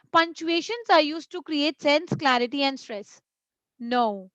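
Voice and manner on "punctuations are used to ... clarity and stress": no pauses. Shown as a demonstration. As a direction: run clarity and stress together without pausing. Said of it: "what if I read it like this".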